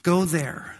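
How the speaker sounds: normal talking voice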